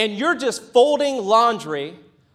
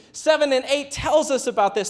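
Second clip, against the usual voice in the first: ordinary talk, nothing marked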